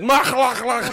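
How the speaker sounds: high, loud throaty gibberish; mock foreign gibberish. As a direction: imitate an unknown tongue with throaty nonsense sounds. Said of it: "what a beautiful tongue that is".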